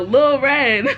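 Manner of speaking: playful calling-out, close to laughing; mock yell. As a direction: call out a name in a half-hearted yell. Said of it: "I heard H D yell".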